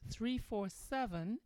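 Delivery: number read out slowly, digit by digit; radio host dictating the call-in number; slow dictation. Real, not acted. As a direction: spell the phone number out slowly for listeners. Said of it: "let me give you that number".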